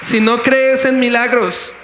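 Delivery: loud, slow, high challenge to the crowd; a slow shouted challenge from the pulpit; preaching shout. Real, not acted. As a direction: call it out slowly, full pulpit force.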